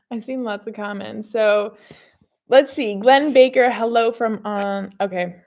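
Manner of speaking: normal talking voice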